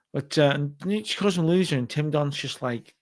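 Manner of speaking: usual speaking voice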